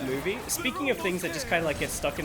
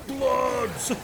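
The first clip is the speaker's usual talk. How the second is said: movie villain line; 6 semitones higher, 3 dB louder than usual. Retold or imitated. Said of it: "Just the guy going".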